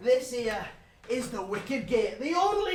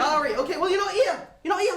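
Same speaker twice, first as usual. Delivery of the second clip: loud and high, fed up with the mate; loud exasperated; 8 semitones higher, 11 dB louder than usual. Real, not acted. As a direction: shout it out, half laughing in disbelief. Exasperated at absent friend.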